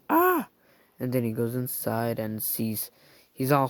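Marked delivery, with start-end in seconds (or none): 0.1-0.5 very high, loud scream. Acted. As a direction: let out a shrill scream. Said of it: "he's screaming".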